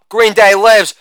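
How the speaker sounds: usual speaking voice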